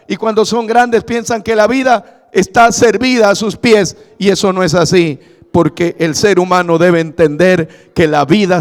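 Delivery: rising, forceful pulpit delivery; preaching at full force. Acted. Pastor urging the congregation, building to forceful preaching. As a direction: drive the message home to the congregation.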